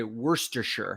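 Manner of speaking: Worcestershire as "WUR-ster-sher"; kitchen Worcestershire. Shown as a demonstration. Naming it in the kitchen, Worcestershire as WUR-ster-sher. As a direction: say Worcestershire with the last part reduced to "sher". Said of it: "I would say".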